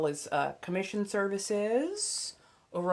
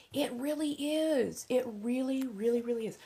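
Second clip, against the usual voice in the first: high gush; 7 semitones higher than usual. Real, not acted. Gushing about chai.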